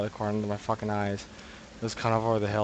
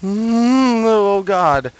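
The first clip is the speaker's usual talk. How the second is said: much higher and louder, rattled; shaken groan; 10 semitones higher, 10 dB louder, much slower than usual. Real, not acted. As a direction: groan out the fright. After jumpscare.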